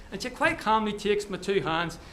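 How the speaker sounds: usual speaking voice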